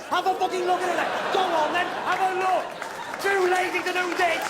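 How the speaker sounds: high, sustained angry shout; furious, high-pitched heckling; angry rant. Acted. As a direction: rant at them at full pitch.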